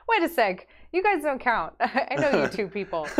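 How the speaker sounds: loud, high, giggly objection; amused protest. Real, not acted. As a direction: call them out through laughter. Mock scolding friends in the chat.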